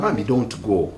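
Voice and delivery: soft, slowed plea, imitating a man begging; mock pleading. Man begging the lady not to leave, soft mock plea. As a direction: beg her gently not to leave, lightly acted.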